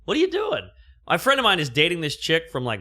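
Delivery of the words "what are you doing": high, baffled mock question; pitched-up puzzled imitation; mock confusion. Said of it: "She's probably like".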